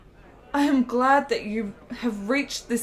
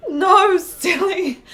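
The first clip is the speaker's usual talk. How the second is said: high and loud, half laugh half sob; tearful happy laugh; brushing off his worry while crying with joy; laughing through tears; 5 semitones higher, 6 dB louder, much slower than usual. Acted. Fosca happy after his promise.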